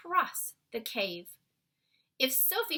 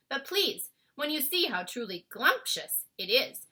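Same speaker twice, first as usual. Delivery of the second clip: BFG voice; 3 semitones higher, 8 dB louder than usual. A narrator's reading. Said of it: "the B F G went on".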